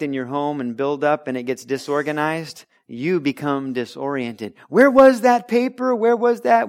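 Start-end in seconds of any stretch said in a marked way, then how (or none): 4.7-6.7 high, loud, flustered hunt for a lost paper; panicked where-did-I-put-it voice; frantic searching. Acted. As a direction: play someone tearing the house apart for a paper. Acting out a frantic search at home.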